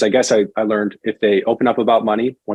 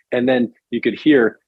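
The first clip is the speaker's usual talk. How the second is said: usual speaking voice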